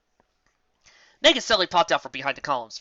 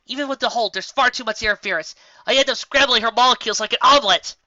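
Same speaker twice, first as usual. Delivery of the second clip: urgent; 8 semitones higher, 8 dB louder than usual. Acted. Explaining under strain.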